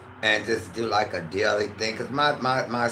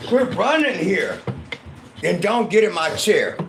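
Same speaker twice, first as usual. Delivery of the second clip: real yell at the dog; scolding yell; 7 semitones higher, 7 dB louder than usual. Real, not acted. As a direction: shout down the running dog. Yelling at dog.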